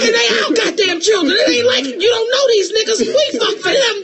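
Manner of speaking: loud, high, cracking with laughter; laughing shout. Real, not acted. Wife laughing at her own story to listeners, shouting. Laughing rant at full volume.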